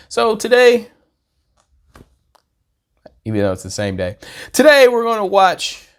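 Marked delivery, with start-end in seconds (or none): 0.1-0.9 excited. Real, not acted. Vlogger launching into the topic. 4.5-5.9 announcer-style launch, much higher and louder; hyped intro. Real, not acted. Vlogger hyping viewers for today's video, loud excited announcement.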